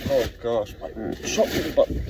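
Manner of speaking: ordinary delivery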